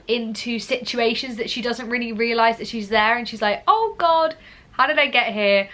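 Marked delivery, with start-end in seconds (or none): 3.7-5.7 high, louder "Oh God", then dropping back; lightly acted bewildered realisation; mock dismay. Vlogger voicing a naive play character swept along, mock dismay.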